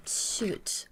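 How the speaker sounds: whispered curse. Real, not acted. Muttered after stream failure.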